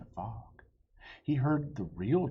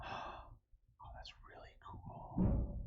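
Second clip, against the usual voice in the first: awed whisper; 7 dB quieter, much slower than usual. Real, not acted. Reader's own awed aside.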